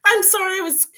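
choking with laughter; coughing, trying to talk. Real, not acted. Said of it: "I was choking all the way through it".

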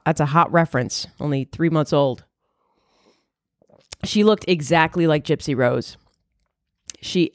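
ordinary delivery

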